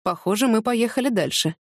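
ordinary delivery

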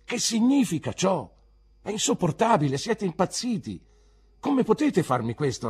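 high, loud, indignant protest; teacher at the end of his patience; exasperated outburst. Acted. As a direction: burst out at an unruly class. Teacher outburst at rowdy class.

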